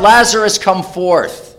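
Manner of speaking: Lazarus, come forth called out loud and high; loud shout. Acted. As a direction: call the dead man out of the tomb. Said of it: "with a loud shout".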